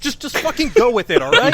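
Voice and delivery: loud, high, fed-up yell; exasperated shout. Retold or imitated. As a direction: snap at them to just accept it. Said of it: "I was just".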